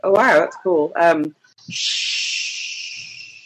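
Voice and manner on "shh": long unvoiced shush; a long, airy "shhh". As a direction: hush everyone with a drawn-out shh. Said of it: "I'll tell everybody to be quiet".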